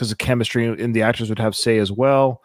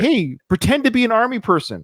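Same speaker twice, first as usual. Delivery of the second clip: lightly acted, higher-pitched pretend soldier; mock army officer; 9 semitones higher than usual. Critic mocking the actress's yelled orders, unconvincing army voice. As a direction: put on a hint of play-acted army command. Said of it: "every time she spoke, when she was yelling orders".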